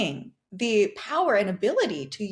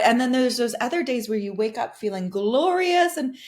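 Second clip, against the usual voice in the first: ordinary talk, nothing marked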